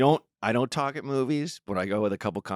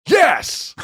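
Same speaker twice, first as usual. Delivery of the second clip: high, loud cheer of yes; excited shout; 9 dB louder than usual. Retold or imitated. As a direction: yell it like a thrilled fan. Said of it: "This one guy used to go".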